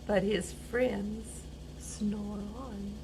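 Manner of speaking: soft, slow, near-whispered line; soft slow bedtime close; hushed ending. A narrator's reading. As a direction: let it drift off into sleep. Friends fall asleep, soft ending.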